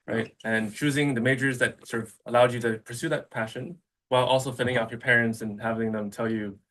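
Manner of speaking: normal talking voice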